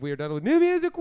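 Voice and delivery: loud, high, building to a whoop; celebratory shout. Real, not acted. Host wraps up the music segment, celebratory shout. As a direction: cheer it out into a long woo.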